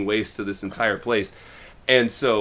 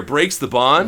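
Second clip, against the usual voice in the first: usual speaking voice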